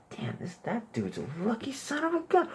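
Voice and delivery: high, soft envious aside; admiring whisper-ish. Retold or imitated. Comic showing the envy a boyfriend wants, high and soft. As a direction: murmur it admiringly. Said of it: "I'm like".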